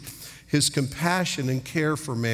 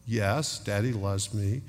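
put-on small, soft, lower-pitched child's reply; imitated child's answer; 5 semitones lower, 4 dB quieter than usual. Child answering Daddy in bed at night, quietly dutiful. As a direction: imitate a little one answering quietly by rote.

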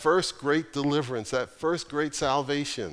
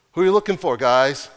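voiced call; 8 dB louder than usual. Acted Jesus calling to soldiers.

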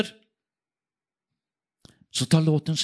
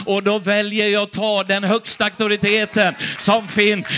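usual speaking voice

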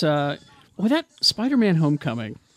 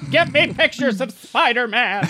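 higher, demanding boss bark; barking newspaper editor; 7 semitones higher, slower than usual. Retold or imitated. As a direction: bark orders like a tabloid editor. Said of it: "best Nathan Lane impressions".

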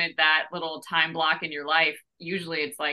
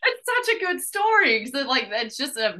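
gushing agreement; 9 semitones higher, 5 dB louder than usual. Real, not acted. Echoing co-host's praise.

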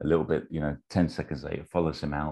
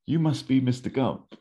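high, put-on presidential greeting; mock statesman's hello, pitched up; Ronald Reagan; 9 semitones higher, 4 dB louder than usual. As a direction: greet him in a raised, acted voice. Acting Reagan greeting Forrest.

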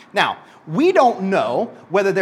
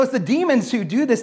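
ordinary talk, nothing marked

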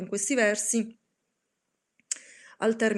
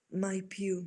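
softer, hushed murmur from the raven; 6 dB quieter than usual. Acted. The raven murmurs its reply to the pleading narrator. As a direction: breathe the answer like a dark omen. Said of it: "Mormorò l'augel".

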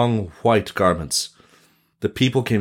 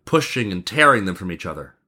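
usual speaking voice

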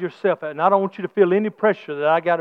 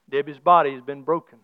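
ordinary delivery

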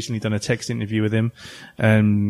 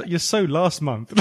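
ordinary talk

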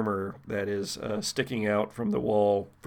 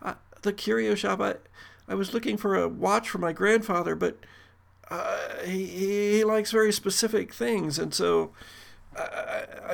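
high, timid, hesitant voice; nervous young woman; 8 semitones higher than usual. Acted. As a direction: stammer it out nervously. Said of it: "looks up at you and says".

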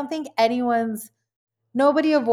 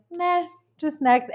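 higher, slow, lazy dismissal; mock shrug-off; 8 semitones higher, slower than usual. Imitating herself quitting a goal, breezy shrug.

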